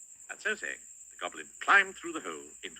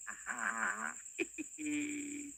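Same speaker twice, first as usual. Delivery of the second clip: high goblin giggle; sly high goblin chuckle; goblin laugh; 6 dB quieter than usual. Acted. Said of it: "smiled the goblin".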